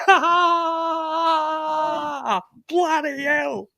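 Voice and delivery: long, high fake laugh; Vinny Eastwood. Mocking a radio guest's terrible laugh, exaggerated fake guffaw. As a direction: drag out a forced, hammy laugh, pitched well up. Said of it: "got the worst fake laugh ever".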